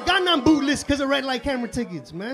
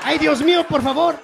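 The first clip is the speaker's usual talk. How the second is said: mock panicked prayer; 4 semitones higher, 7 dB louder than usual. Praying while spinning car.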